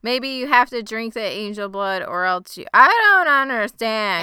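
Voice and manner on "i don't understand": loud, high, drawn-out confusion; exasperated bafflement. Real, not acted. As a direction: wail it slowly, totally lost. Baffled by the plot holes.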